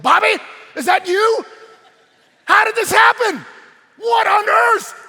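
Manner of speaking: high, shouted disbelief; freaking out. Acted. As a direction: yell it like stunned townsfolk. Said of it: "they were freaking out".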